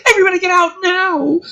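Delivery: high, loud, fast yell to flee; urgent shout. Acted. Casey yelling at the lab to get out. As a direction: scream at everyone to run. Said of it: "backs away, full defense mode".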